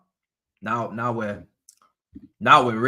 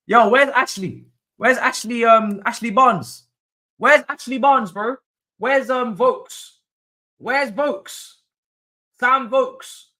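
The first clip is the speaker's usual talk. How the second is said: high, loud searching calls; loud calling; 12 semitones higher, 7 dB louder, slower than usual. Real, not acted. Fan shouting for missing strikers, high and loud. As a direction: call out the names, raising the voice. Hunting a forgotten name.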